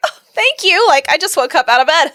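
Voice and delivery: flattered. Retold or imitated. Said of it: "And I was like".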